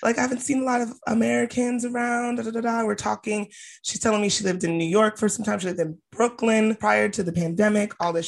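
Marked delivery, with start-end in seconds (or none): 0.0-2.8 high, excited, gushing tourist voice. Retold or imitated. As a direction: squeal it like an overeager stranger. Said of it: "and she's like".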